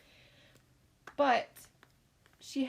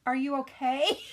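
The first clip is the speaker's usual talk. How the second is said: called up the stairs, louder and higher; calling out; 5 semitones higher, 7 dB louder than usual. Retold or imitated. Mom calling up the stairs to her daughter. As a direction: call up to a child upstairs. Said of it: "called out".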